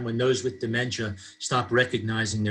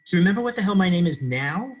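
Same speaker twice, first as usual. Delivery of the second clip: higher, mock disbelief; playfully exasperated, pitched-up question; feigned shock; 6 semitones higher, 4 dB louder, faster than usual. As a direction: feign being shocked at being forgotten. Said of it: "I feign utter shock and disbelief".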